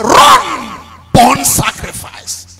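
a hint of God giving orders; commanding voice of God. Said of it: "When the Lord said".

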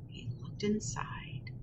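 unvoiced hushed narration; hushed storyteller whisper; suspense whisper. A narrator's reading. Suspense before finding the owl.

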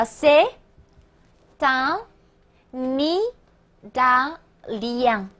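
c'est, un, Italien in separate pieces with long pauses; slow, spaced-out c'est / un / Italien; broken-down liaison demo. Shown as a demonstration. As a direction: teach c'est un Italien piece by piece, n carried onto Italien. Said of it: "注意连读连诵啊".

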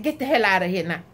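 ordinary delivery

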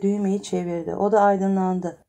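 ordinary delivery, nothing marked

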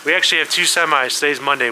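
ordinary talk, nothing marked

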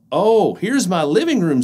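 high, cheerful little mouse. Acted. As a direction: show it off brightly. Mouse speaks.

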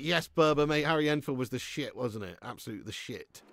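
usual speaking voice

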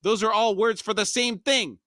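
high-pitched exasperated character shout; hammed-up bickering yell. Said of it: "Puss shouted".

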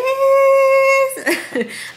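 loud high-pitched laugh; high giggle. Real, not acted. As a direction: burst into a giggle. Reveals table, laughs.

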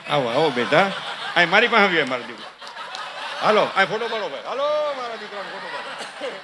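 soft, hushed fatherly coaxing. Acted. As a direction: gently call the child over, softly. Father coaxes the crying child. (ધીમા અવાજે બાપનું લાડ)

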